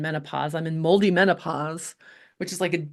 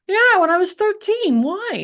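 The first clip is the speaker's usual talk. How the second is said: surprised answer; 13 semitones higher, 6 dB louder than usual. Retold or imitated. Retelling the doctor asking about ticks.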